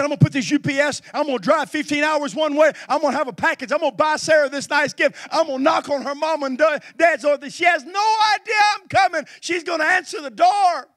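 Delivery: loud, high, eager plans shouted out; bursting with a secret surprise; excited son. Retold or imitated. Preacher voicing his son planning a surprise, loud and excited.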